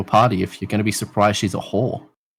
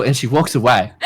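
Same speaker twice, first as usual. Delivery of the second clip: ordinary talk, nothing marked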